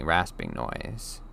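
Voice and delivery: plain reading voice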